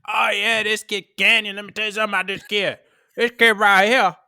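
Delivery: hammed-up Midwesterner with a Southern slip; exaggerated regional accent, Midwest gone Bayou; mock Midwest accent. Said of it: "somewhere in the Midwest, and they were like".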